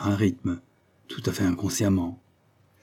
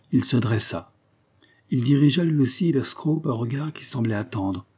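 plain reading voice

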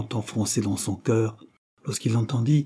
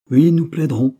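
louder, higher angry outcry; hollow cry; 5 semitones higher, 7 dB louder than usual. A narrator's reading. The colonel bursting through the door at his wife, crying out. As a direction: burst in with a grim defiant cry. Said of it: "s'écrie d'une voix sourde le colonel".

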